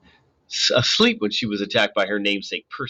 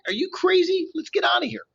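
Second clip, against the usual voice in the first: high panicked disbelief. A narrator's reading.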